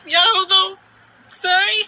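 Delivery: overcome, apologizing through rising tears; choked up. Real, not acted. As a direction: fight back tears, voice pushed high. Breaking down while telling her hurt.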